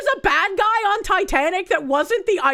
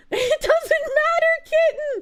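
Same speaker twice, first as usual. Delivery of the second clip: high, slow, mock tearful wail; hammed-up crying line; 11 semitones higher, 3 dB louder, slower than usual. Fake-sobbing at the chat like a melodrama. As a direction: overact a weepy movie line.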